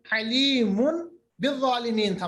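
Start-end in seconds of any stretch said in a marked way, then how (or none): none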